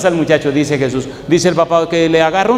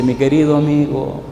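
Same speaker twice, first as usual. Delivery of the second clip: mild sorrowful doctor; 4 semitones lower, 6 dB quieter than usual. Retold or imitated. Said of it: "con los ojos de, llenos de lágrimas".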